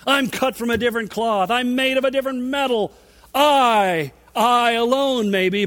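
blustering disciple insisting he alone stands firm; Peter's vehement boasting. Retold or imitated. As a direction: boast emphatically, punching each claim to stand apart. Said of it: "I can almost hear Peter".

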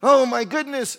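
high, loud burst of pretend alarm; mock dismay. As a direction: cry out in exaggerated dismay. Mocking a fretful believer's panic.

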